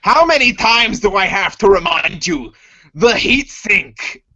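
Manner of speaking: exasperated boss, heat sink slowed down; scolding. Acted. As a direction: nag him for forgetting again. Droid scolding about heat sink.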